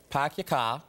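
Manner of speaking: R's dropped Boston style; Boston accent, no R's. Shown as a demonstration. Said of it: "you wanna try it one more time".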